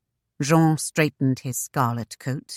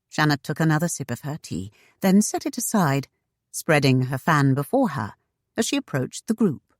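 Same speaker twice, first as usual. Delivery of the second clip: plain reading voice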